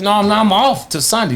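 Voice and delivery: high-pitched, insistent pushback; retold protest. Fighter protesting to coach that he's off till Sunday, indignant.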